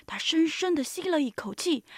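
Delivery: plain reading voice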